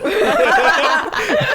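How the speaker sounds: high, loud, slow through laughter; laughing. Real, not acted. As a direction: crack up while promising. Cracking up while promising demo.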